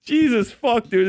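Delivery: usual speaking voice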